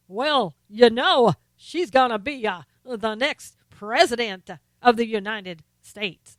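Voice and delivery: loud, slow, drawn-out pastor oratory; Obama. Acted. Host mocking a president's fake accent, booming preacher. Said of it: "his fake accent ... he sounds like some evangelist pastor".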